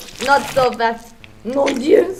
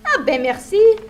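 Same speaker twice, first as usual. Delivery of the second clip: higher, louder quick Québécois thanks; Québécois French; 6 semitones higher, 6 dB louder than usual. Acted. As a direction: toss off a chirpy Québécois merci. Lumberjack answers in French.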